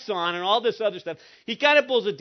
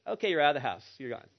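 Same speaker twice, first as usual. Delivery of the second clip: low, soft mock sternness; deadpan banishment; 6 semitones lower, 6 dB quieter, faster than usual. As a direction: drop it quietly, as a dry joke. Joking retold banishment of son.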